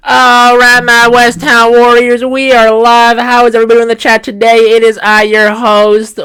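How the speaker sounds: put-on Western accent. Host welcoming the chat on the ranch, hammed-up Western accent. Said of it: "I will do a Western accent as the intro".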